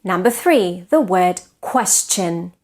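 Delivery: usual speaking voice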